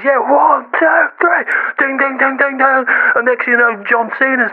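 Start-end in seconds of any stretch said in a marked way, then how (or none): 0.0-1.4 high, drawn-out count; excited mock referee's count; wrestling referee. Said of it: "the referee goes". 1.8-2.8 high, repeated "ding"; imitated ring bell. Imitates the bell after count.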